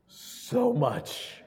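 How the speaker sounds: high, tender "so much"; tender reply. Retold or imitated. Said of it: "I go".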